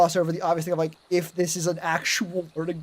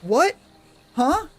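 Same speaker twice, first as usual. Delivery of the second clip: higher, rising to a startled squeak; baffled disbelief; 6 semitones higher than usual. Retold or imitated. Person realizes they miscounted, mock baffled and rising. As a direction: get more and more lost as it sinks in. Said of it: "they're like".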